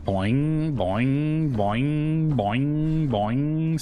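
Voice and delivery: bouncy repeated boing noises; boing like a spring toy; playful boing sounds. Pretend. Mimicking character bouncing.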